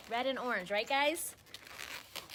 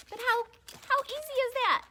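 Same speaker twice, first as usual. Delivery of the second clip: high, loud and thrilled; excited; 13 semitones higher, 5 dB louder than usual. Real, not acted. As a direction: cheer them on with delight. Excited teacher cheering on viewers.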